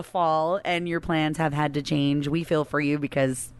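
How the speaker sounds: usual speaking voice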